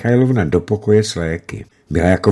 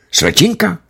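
perked-up question; 11 semitones higher than usual. A narrator's reading. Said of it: "oživil hned major".

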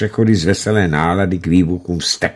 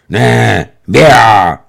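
sarcastic grumble; 3 semitones higher, 7 dB louder, much slower than usual. A narrator's reading. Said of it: "zabručel Stručkov".

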